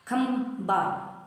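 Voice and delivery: खम्बा drawn out very slowly; slow example word. Shown as a demonstration. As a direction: stretch खम्बा to show the spelling. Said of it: "तो ऐसे नहीं".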